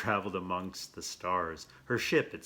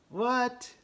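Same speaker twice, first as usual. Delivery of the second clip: surprised what; 6 dB louder than usual. Real, not acted. Amazed reaction to picture.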